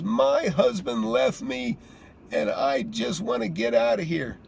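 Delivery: crying. Retold or imitated. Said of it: "And she just was crying".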